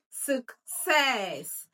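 "success" drawn out as suk... SESS; stress on second syllable. Shown as a demonstration. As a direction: lead a class repeat of "success", long SESS. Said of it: "let's pronounce it together".

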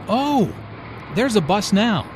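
sudden high oh of surprise; surprised. Acted. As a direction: spot the bus and blurt it out. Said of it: "Oh" is an exclamation used to express alarm or surprise".

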